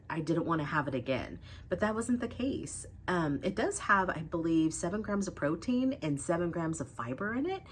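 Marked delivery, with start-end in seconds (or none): none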